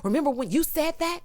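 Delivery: mock finger-pointing accusation, pitched high; imitated accusing mom. Commentator mimicking the mom confronting him, mock accusing. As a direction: jab it out, imitating an insistent mother. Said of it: "you started pointing your finger, leaning all in".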